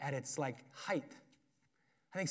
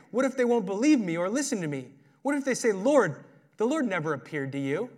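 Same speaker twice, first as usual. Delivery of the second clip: higher, louder whining protest; whiny Moses; 4 semitones higher, 6 dB louder than usual. Retold or imitated. Moses whining his excuses to God, in a whiny voice. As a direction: whine out excuse after excuse to God. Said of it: "like, a whiny voice".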